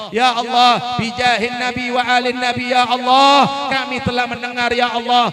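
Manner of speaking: loud, fervent supplication with each ya Allah called out; fervent prayer. Real, not acted. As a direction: lead the congregation in pleading prayer. Leads closing prayer. (doa penutup yang lantang dan khusyuk)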